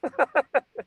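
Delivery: bursting out laughing, high; amused high-pitched laugh. Real, not acted. Laughs at code switching.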